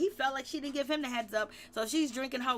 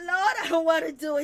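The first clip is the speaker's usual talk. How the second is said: laughing impression; 5 semitones higher, 8 dB louder, faster than usual. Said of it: "He was like".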